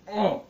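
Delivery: high loud burning cry; pained exclamation. Real, not acted. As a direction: cry out as the spice burns. Hot sauce challenge, burning.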